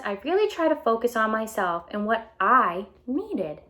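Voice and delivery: bright storybook read-aloud voice; animated picture book reading; reading to children. A narrator's reading. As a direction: read it out to a class of kids.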